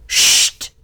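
whispered shush; hushing. A narrator's reading. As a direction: hush the others urgently. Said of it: "sagte Ford".

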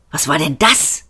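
understated hissed question; hiss through teeth. A narrator's reading. Seyfort startled by a flying object, hissing. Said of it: "zischte Seyfort durch die Zähne".

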